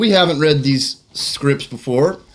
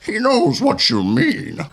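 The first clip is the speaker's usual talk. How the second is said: Bane voice; 6 semitones higher than usual. Acted. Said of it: "as a Bane".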